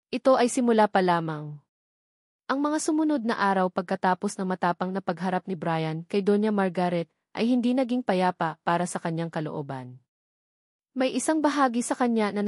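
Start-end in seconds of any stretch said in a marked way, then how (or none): none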